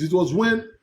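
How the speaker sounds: ordinary delivery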